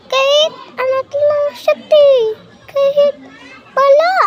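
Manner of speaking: high, loud tearful wail; crying wail. Real, not acted. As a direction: wail it out through tears. Crying over missing the past.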